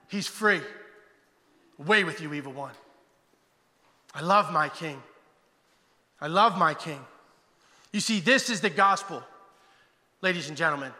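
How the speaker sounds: passionate sermon, rising to a high ring; preaching. Acted. Pastor calling the congregation out of the grave, fervent.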